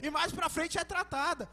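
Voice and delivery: loud, driving sermon delivery; full-force preaching. Acted. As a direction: preach it out to the whole church. (pregação inflamada e em voz alta)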